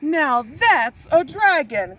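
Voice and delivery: croaked. A narrator's reading. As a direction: croak it out in awe and dread. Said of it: "croaked Vi".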